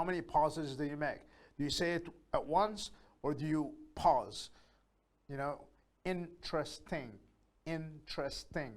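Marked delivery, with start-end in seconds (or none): 6.0-8.7 INTERESTING softly in three beats; split into syllables. Shown as a demonstration. Teacher counting pauses in interesting, split into syllables. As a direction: quietly chop interesting into in, tres, ting. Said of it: "That's three syllables".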